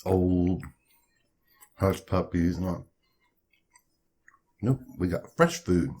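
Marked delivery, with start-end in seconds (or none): none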